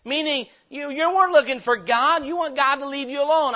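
ordinary talk, nothing marked

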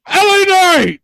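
"L A Knight" blurted high and loud after the pause; loud mock reveal. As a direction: shout "L A Knight" like a punchline after a fake pause. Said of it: "Dramatic pause".

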